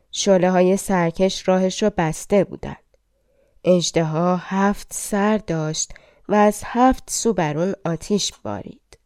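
ordinary delivery, nothing marked

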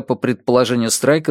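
ordinary delivery, nothing marked

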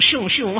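loud, high-pitched cartoon pistol shots; imitated gunshots. Lecturer shoots back at absent student, playful gunfire noises. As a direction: fire back with silly "shyung" noises.

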